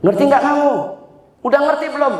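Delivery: high, pressing demand; mock scolding lecturer. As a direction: quiz them condescendingly, pitched high. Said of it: "saya bilang kepada mahasiswa saya".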